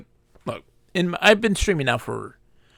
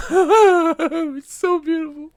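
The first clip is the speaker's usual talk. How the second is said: high, loud, slow swooning gush; mock swooning; 13 semitones higher, 6 dB louder, slower than usual. Streamer mock swooning at the unicorn, high and slow. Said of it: "And I'm just like".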